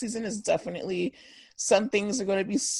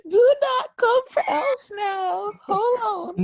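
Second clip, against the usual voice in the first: high, slow, half-crying shout; mock outrage; 13 semitones higher, 6 dB louder than usual. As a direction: defend it like it's personal. Mock outrage defending elf products.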